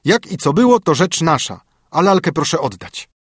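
nanny sneering at the mother; loud unfriendly servant; hostile retort. Acted. Nanny demands the doll.

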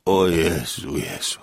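low groan of despair, fading away; cicha rozpacz. A narrator's reading. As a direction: groan it low and slow, head in hands. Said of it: "uchwycił się za głowę". (niski jęk rozpaczy)

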